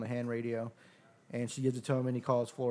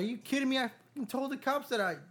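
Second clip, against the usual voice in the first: much higher exasperated retelling; incredulous; 12 semitones higher than usual. Retold or imitated. Recounting the useless phone call to friends, exasperated and high. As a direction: voice jumping up in disbelief. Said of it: "I was like".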